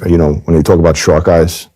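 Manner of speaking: usual speaking voice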